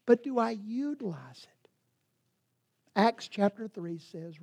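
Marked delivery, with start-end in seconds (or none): 0.1-1.7 breathy hushed ask; whispered question. Real, not acted. Preacher asks the congregation in a whisper. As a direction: drop to a whisper and probe.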